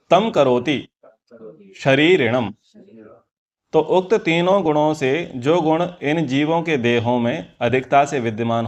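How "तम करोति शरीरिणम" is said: measured call-out for others to repeat; Sanskrit verse recitation. Shown as a demonstration. Teacher giving students the verse to repeat, Sanskrit recitation. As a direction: recite phrase by phrase.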